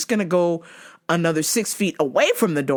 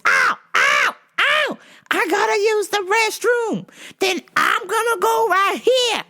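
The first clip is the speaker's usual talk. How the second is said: very high hammed-up customer yell; mock yelling. Customer refused the restroom, mock yelling. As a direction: imitate her yelping and threatening, pitched way up. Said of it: "She was yelling".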